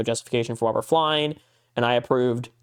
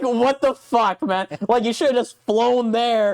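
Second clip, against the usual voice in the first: exasperated outburst; 8 semitones higher than usual. Retold or imitated. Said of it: "It was like".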